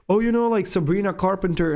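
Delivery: lightly hammed-up chatty friend; imitated friends. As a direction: imitate an eager friend, voice lifted high. Acted quote of friends asking him.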